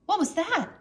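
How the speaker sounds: plain reading voice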